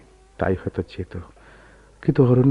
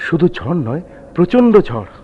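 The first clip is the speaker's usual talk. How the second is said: higher and louder on প্রচণ্ড ঝড়; rising alarm; 8 semitones higher, 8 dB louder than usual. Acted. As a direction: swell with alarm at the storm. Husband warns of storm. (আতঙ্কে গলা চড়ানো)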